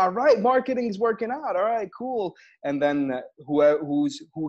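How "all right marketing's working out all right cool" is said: high-pitched, pleased announcement to the crowd; upbeat stage banter. Retold or imitated. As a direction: crow it brightly to a live audience. Retelling cheerful banter to audience.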